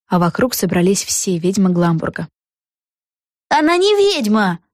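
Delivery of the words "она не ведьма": high-pitched cry from the crowd. A narrator's reading. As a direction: call out an accusation on a raised pitch. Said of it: "крикнул кто-то".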